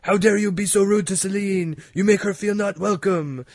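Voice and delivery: indignant husband. Retold or imitated. Mock husband scolding the city on the news for making [name] cry. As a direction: play the crusty old husband scolding the city. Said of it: "He's like".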